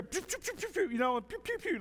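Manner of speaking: quick mock laser noises; blaster sounds. Preacher imitating Star Wars blasters, mock zaps. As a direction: spit out toy laser-gun zaps. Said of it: "like Star Wars".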